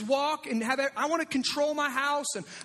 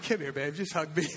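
tender, laughing; 8 semitones lower than usual. Retold or imitated. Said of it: "I'm like".

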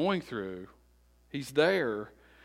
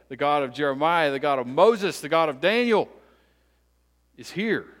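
loud, raised, rhythmic litany; full-force preaching; 4 semitones higher, 6 dB louder than usual. Acted. Preacher proclaiming God to the congregation, full-force preaching. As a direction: build through each repeated phrase.

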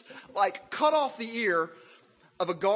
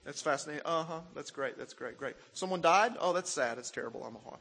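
mock distracted murmur, softer, trailing off; bored half-listening; 4 semitones lower, 6 dB quieter than usual. Preacher mocking himself half-listening to someone. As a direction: mumble along without really listening. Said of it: "I'm kinda listening to them like".

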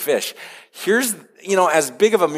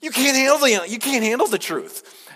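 much higher, mock movie-style defiance; defiant people; 14 semitones higher than usual. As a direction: fling it back like the famous courtroom outburst. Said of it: "And the people are like".

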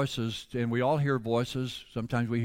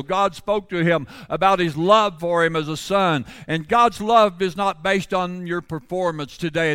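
raised, loud pulpit voice; fervent preaching; 5 semitones higher, 7 dB louder than usual. Real, not acted. Preacher calls congregation to worship, loud and fervent. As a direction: rouse the congregation.

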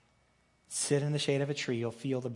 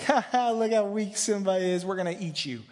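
mocking hyenas; 7 semitones higher, 5 dB louder than usual. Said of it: "they're like".

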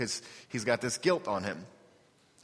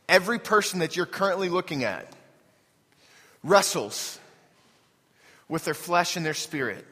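preaching; 5 semitones higher than usual. Acted. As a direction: preach it to the room with conviction. Preaches to the congregation.

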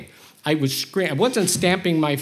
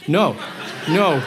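ordinary talk

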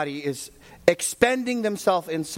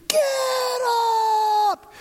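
shout; 4 dB louder than usual. Retold or imitated. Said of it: "I said".

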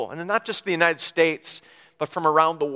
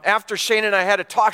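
ordinary talk

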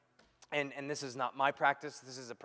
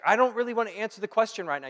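annoyed wife; 8 semitones higher, 11 dB louder, faster than usual. Retold or imitated. Wife's curt reply quoted.

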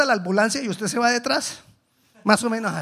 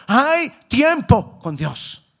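ordinary talk

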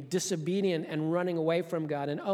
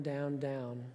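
normal talking voice

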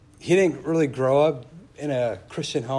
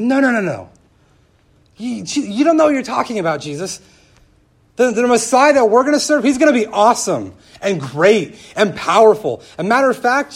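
loud, high, overexcited objection; hammed-up insistence, pitched way up and racing ahead with zeal; fervent protest; 9 semitones higher, 11 dB louder than usual. Disciples objecting to Jesus' suffering, fervent protest.

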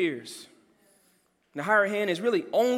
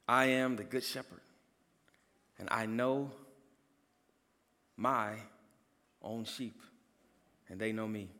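soft repeated verse; 9 semitones lower, 8 dB quieter than usual. Shown as a demonstration. Pastor repeats the verse for the congregation, hushed. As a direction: let the words sink in quietly. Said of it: "says it like this".

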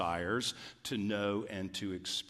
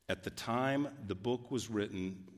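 ordinary talk

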